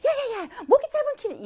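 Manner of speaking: very high, flustered yelping; hammed-up high-pitched panic; fussy overreaction. Shown as a demonstration. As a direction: demonstrate making a big fuss. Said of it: "호들갑이 뭐야?".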